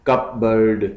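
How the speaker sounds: cupboard in two beats, cup then board; split into syllables. Shown as a demonstration. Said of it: "टू syllables हैं इसके".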